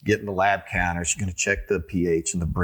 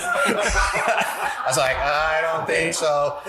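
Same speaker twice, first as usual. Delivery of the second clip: laughing reply; 8 semitones higher, much slower than usual. Retold or imitated. Own reply amid laughter.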